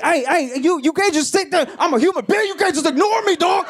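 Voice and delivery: high, strained mock rant; angry stranger. Man on train yelling at ignoring rider, high mock anger. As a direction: demand to be seen, hammed-up outrage. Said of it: "he still is getting madder and madder".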